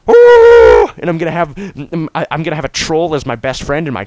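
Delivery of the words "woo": loud whoop. Real, not acted. Cheering at the game.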